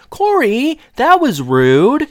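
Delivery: high, slow motherly reproach; scolding mom. Retold or imitated. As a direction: gently scold like a sitcom mom. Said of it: "his mom is like".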